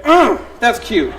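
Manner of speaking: usual speaking voice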